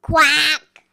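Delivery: loud quack; duck quack. A narrator's reading. Duck greets the little truck, loud quack. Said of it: "said a duck".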